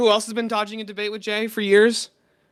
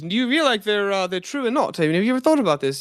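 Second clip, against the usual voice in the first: hammed-up British debater with a rolling lilt; put-on posh British voice asking question after question; mock British accent; 3 semitones higher than usual. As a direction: mimic a British philosopher, mockingly. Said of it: "in the British accent".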